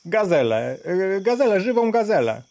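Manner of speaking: high, fast shouted hawking; loud shout. A narrator's reading. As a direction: peasant shouting his wares. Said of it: "krzyczał głośno chłop".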